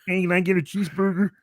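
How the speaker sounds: high, thin Kermit voice ordering food; playful Kermit impression at the drive-through; Kermit the Frog. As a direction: squeak it out like the frog puppet. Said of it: "ordering the food as Kermit the Frog".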